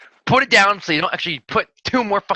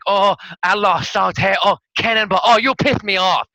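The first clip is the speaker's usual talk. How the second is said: mock Two Gun; 4 semitones higher, 5 dB louder than usual. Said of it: "Usually it's like".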